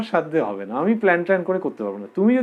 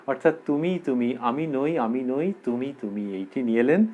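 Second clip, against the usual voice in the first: usual speaking voice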